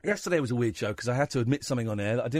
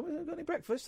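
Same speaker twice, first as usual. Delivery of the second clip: high, soft little plea; lightly voiced hungry cat at the door; 13 semitones higher, 9 dB quieter than usual. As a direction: pipe up softly as a pleading cat.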